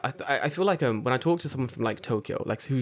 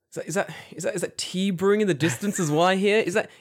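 higher, wistfully dramatic wondering; mock homesick Londoner; 8 semitones higher, 4 dB louder than usual. Londoner joking about distant sounds of home, mock homesick. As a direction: play up hearing home calling from afar. Mock hearing his homeland calling.

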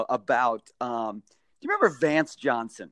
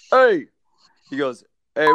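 booming call; 6 dB louder than usual. Retold or imitated. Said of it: "in his big booming voice".

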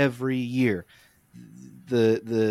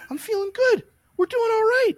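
much higher, louder, put-on triumphant voice; cocky patient; 4 dB louder than usual. Mocking his overconfident self, squeaky and loud. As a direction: ham up the cocky comeback kid.

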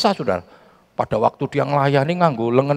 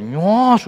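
high sizzling "nyos"; sizzle sound; 9 semitones higher, 4 dB louder than usual. Retold or imitated. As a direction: mimic the hiss of a hot iron. Mimicking hot iron on skin.

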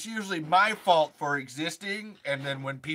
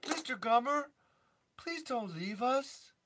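pleading film character; 9 semitones higher, 3 dB quieter than usual. Acted. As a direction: beg gently in a small high voice. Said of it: "Does a Tremors four character impression".

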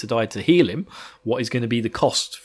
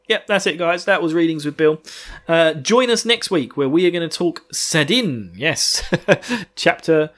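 ordinary delivery

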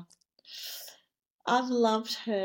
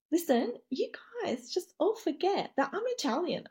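higher, swooping mock boast; Domenica imitation; 5 semitones higher than usual. Retelling a housemate's boast to the hosts, imitated and hammed-up. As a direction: put on her proud, showy pitch. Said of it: "She goes".

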